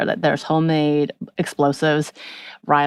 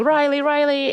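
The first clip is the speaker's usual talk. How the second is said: a mock call of a name, pitched way up; retold screaming; 11 semitones higher than usual. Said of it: "She runs screaming".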